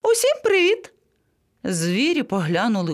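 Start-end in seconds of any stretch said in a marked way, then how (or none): 0.0-0.9 very high, chirpy greeting; voice from above. A narrator's reading. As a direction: sing out a greeting from a tree. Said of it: "згори раптом долинув чийсь голос". (високе вітання згори)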